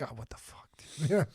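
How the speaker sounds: usual speaking voice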